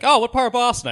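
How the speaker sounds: high, bright mock local asking; eager Bostonian. Mocking a Boston local who asks which part, high and eager.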